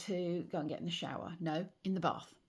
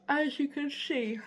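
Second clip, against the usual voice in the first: yawning; 7 semitones higher than usual. Real, not acted. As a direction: end of a yawn in the words. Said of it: "I'm yawning a lot".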